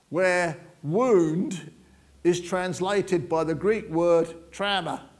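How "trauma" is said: trauma with an American TROW vowel. Shown as a demonstration. Preacher reading the Greek word, said the American way. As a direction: say trauma the American way, rhyming with cow. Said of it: "I sound a bit American talking like that".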